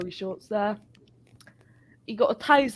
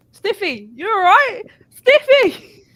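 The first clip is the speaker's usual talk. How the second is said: rising panicked shout of a friend's name; alarmed shouting; 10 semitones higher, 10 dB louder, slower than usual. Retold or imitated. Shouting to a mate collapsed on the floor. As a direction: yell for him, getting more frantic.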